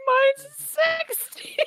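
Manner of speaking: high, slow gleeful squeal; excited squeal. Real, not acted. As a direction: crow about it. Excited claim.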